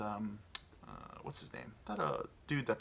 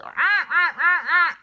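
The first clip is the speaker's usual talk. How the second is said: high, loud repeated goose squawks; imitated goose squawks; 14 dB louder, slower than usual. Man acting out the geese attacking him, high loud squawking.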